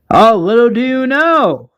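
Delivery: high, sing-out villain taunt; mysterious voice. Acted. Unseen Marauder leader interrupts survivors, mysterious taunt. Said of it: "we hear this mysterious voice".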